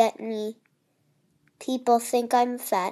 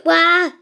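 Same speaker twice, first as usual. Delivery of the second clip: louder startled "wah"; loud startled wail; 4 semitones higher, 7 dB louder than usual. Real, not acted. Gamer wailing as he is nearly eaten. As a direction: burst out in surprise.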